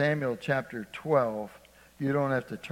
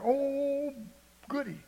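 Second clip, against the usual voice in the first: put-on high, childlike squeal of delight; excited little boy; 10 semitones higher, 4 dB quieter, slower than usual. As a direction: squeal like a kid told he's going to the fair. Preacher voices delighted little boy.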